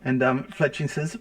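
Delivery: ordinary talk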